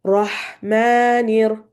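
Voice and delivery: "Rahmanir" drawn out very slowly; slow recitation. Shown as a demonstration. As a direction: stretch "Rahmanir" out. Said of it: "we'll read one word at a time".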